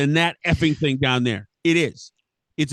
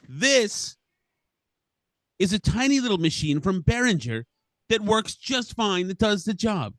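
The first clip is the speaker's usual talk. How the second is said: higher, upbeat pitch for the rival brand; mock ad read; 4 semitones higher than usual. Podcaster mock-advertising a rival mixer, higher cheery ad voice. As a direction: sell it like a cheesy commercial. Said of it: "here's my commercial".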